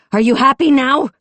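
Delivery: high furious scream at the car. A narrator's reading. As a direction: scream it with everything you have. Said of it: "she screamed at the car".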